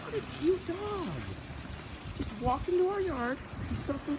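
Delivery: very high cooing; pet voice. Real, not acted. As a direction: gush at the dog. Cooing at dog.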